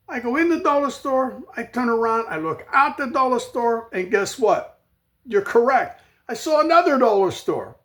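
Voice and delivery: comic exasperation. Real, not acted. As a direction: build the absurdity to the end. Comic build about dollar stores.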